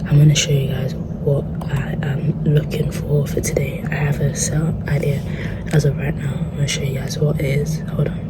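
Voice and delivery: hushed library whisper. Real, not acted. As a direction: whisper so the library can't hear. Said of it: "I have to whisper because obviously I'm in the library".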